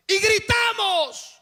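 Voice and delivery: climbing sermon shout; fiery preaching. Acted. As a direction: preach it out to the whole church at full force. Preacher rises to full force.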